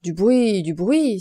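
loud outraged exclamation, repeated; loud old servant crying out; loud indignant cry. Acted. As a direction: throw the words back loudly. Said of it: "s'écrie Honorine".

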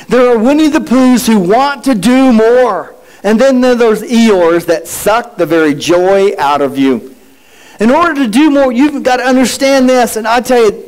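ordinary talk, nothing marked

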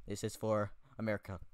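ordinary talk, nothing marked

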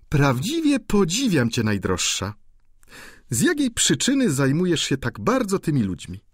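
amused viscountess. A narrator's reading. Viscountess laughingly explains Eugene to the duchess, teasing. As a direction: tease the duchess about him.

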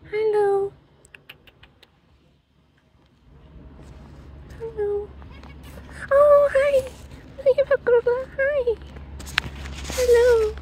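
high cooing voice to a cat. Real, not acted. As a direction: coo at the animal in a high voice. Greeting animals in high voice.

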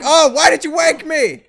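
high loud grumpy wake-up; indignant creature. Retold or imitated. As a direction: complain loudly at being woken. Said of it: "he's like".

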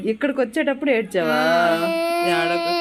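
mother calling out to her daughter, high and loud. Real, not acted. Mother questions upset daughter.

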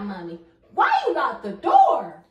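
usual speaking voice

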